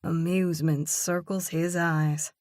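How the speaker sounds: ordinary delivery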